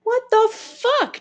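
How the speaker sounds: high, slow shocked outburst; stunned disbelief, drawn out. Real, not acted. Shocked reaction to shooting.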